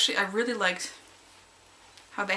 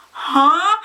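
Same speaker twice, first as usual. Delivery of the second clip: baffled huh; 8 semitones higher, 11 dB louder than usual. Retold or imitated. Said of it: "And I'm like".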